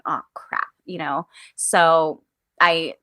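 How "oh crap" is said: whispered, deflated curse; muttered oh crap. Retold or imitated. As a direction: breathe it out under your breath, defeated. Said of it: "I just looked around and I went".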